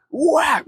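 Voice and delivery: smack it out like a paddle hit; imitated paddle smack. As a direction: mouth sound of a paddle swat. Paddle hitting classmate.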